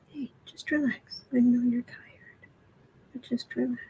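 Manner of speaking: soft whisper to a sleepy cat; whispered reassurance to a pet; soothing whisper. Real, not acted. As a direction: hush the tired cat gently.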